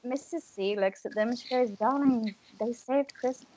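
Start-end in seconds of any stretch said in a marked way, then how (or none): 1.7-3.5 soft, hushed tender line; gentle reassurance. Acted. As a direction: say it softly to worried parents. Missus C gently reassures the parents.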